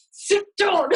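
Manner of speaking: nursery worker mock-scolds a toddler, laughing; mock stern command. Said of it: "and you're like".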